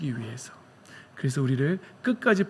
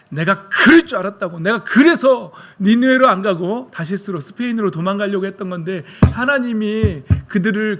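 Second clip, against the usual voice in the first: higher and louder, indignant complaint; Jonah's angry outburst; 5 semitones higher, 7 dB louder than usual. Acted. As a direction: rant like a prophet who knew it. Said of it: "그때 요나가 뭐라 그러지요?".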